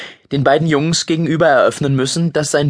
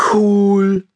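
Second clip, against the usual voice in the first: mumbled with full mouth; 9 semitones higher, 3 dB louder than usual. Acted. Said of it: "murmelte Finn mit vollem Mund".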